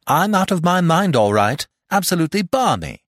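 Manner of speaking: loud, high-pitched outburst; agitated insistence. Acted. Jack insisting to the doubting doctor, high and agitated. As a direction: blurt it out, overwrought.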